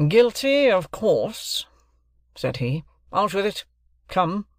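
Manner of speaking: high, loud, sneering challenge; cold sarcasm. Acted. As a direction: taunt the room coldly. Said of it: "coldly and sarcastically".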